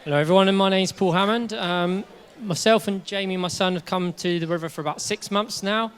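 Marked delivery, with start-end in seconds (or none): none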